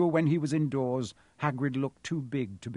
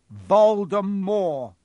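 loud, high, drawn-out shout; bellow; 6 semitones higher, 10 dB louder, much slower than usual. A narrator's reading. Harry shocks crying Hagrid with the name, bellowing. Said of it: "Harry bellowed".

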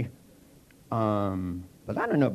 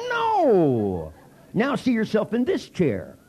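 impatient correction; 8 semitones higher, 4 dB louder, much slower than usual. Retold or imitated. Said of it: "and they go".

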